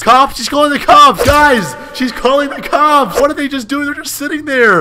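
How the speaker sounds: high, loud panicked yelling; panicked alarm. Real, not acted. Warning the family she's calling the cops, panicked. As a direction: yell a frantic warning to the others. In-game burglary, woman calls police.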